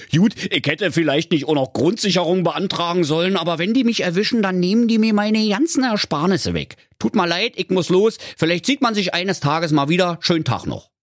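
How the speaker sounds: high, cartoonish bear with a Berlin twang; the bear excusing himself in a hurry, voiced high. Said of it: "der Berliner Bär sprach".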